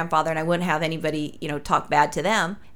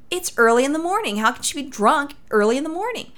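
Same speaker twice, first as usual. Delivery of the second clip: higher-pitched incredulous question; mildly exaggerated astonishment, pitched up; retold disbelief; 7 semitones higher than usual. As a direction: act out baffled disbelief. Retelling her disbelief at mom's claim.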